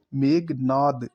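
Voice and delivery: ordinary talk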